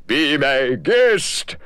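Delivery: slow, easy genie; lightly voiced obliging genie. Genie allowing another wish, slow and easy. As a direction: grant it with a shrug.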